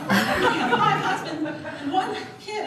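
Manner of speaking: slow, high, laughing through the slip; laughing as she repeats her own botched line; laughing retold blunder. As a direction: replay the flub, giggling. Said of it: "I said I had five husbands".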